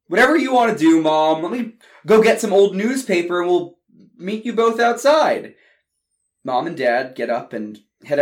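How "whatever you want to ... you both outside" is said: high, loud eager-to-please reply; brother answering mom. Acted. Chris agreeing to mom's fire plan, high and loud. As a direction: jump to it like the good son.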